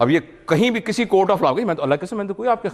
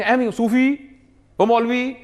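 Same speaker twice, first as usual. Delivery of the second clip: mock taunting call; 8 semitones higher, slower than usual. Teacher calling student by nickname.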